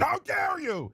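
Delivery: high, pearl-clutching gasp of offence; mock outrage. Host mocking The View panelists, high mock outrage. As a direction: play the scandalised panelist, way up high. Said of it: "they said".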